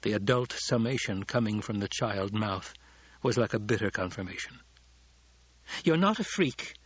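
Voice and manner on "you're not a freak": sharp, high snap; snapping. A narrator's reading. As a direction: snap it back protectively. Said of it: "Hara snapped".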